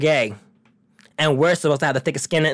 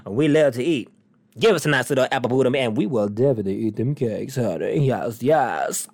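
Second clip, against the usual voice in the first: sultry sass; 7 semitones lower than usual. Real, not acted. Sassy innuendo about eating cakes.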